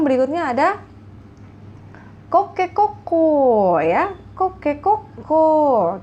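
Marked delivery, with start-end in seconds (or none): 2.3-6.0 high, loud, drawn-out crow; rooster crow imitation. Shown as a demonstration. Teacher shows students the rooster sound, loud high crow. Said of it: "Kira-kira seperti itu bacanya".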